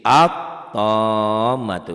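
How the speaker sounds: At-tammatu chanted slowly and loudly, lam dropped into a doubled t; recited Arabic example. Shown as a demonstration. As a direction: sing At-tammatu long and drawn out, Quran style. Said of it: "Di atas lam ini tidak ada apa-apa". (At-tammatu dilantunkan pelan, lam melebur jadi tt)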